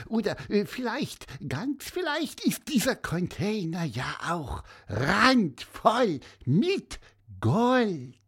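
high, excited little bird; chirpy and eager, dreaming of treasure; squeaky bird voice. A narrator's reading. Excited bird character imagining treasure.

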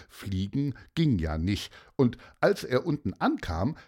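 ordinary delivery, nothing marked

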